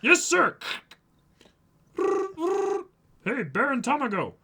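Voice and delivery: high, loud eager reply; squeaky guard. Acted. Guard answering and hailing the Baron. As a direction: snap to attention like a little soldier.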